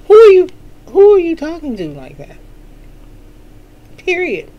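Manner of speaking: playful indignation at a rude colleague; mock scolding. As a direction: put him in his place, half joking.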